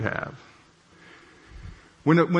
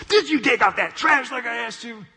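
angry demand; 8 semitones higher than usual. Retold or imitated. Angry wife confronting tired husband about the trash, higher and sharp. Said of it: "she got an angry look on her face".

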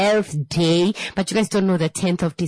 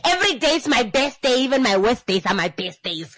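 ordinary delivery

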